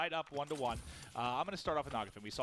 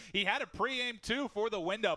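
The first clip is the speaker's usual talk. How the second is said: excited; 8 semitones higher, 5 dB louder, slower than usual. Real, not acted. Caster reacts to sniper fight.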